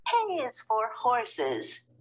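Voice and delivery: mother minding careful speech; correcting mother. Acted. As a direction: gently correct his speech. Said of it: "said Missus Lambchop".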